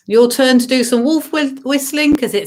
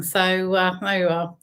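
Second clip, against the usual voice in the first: ordinary talk, nothing marked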